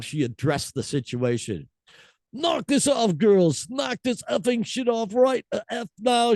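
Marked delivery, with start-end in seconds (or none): 2.3-6.3 high mock Scottish scolding; furious singer. Host reads singer ordering girls to stop, high mock scold. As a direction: tell the crowd off in a high put-on voice. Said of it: "the furious singer".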